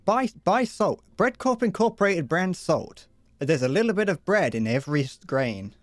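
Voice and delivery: hammed-up commercial voiceover; mock ad read. A streamer pitching a fake canned product, mock ad voice. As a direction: sell the product like a cheesy TV advert.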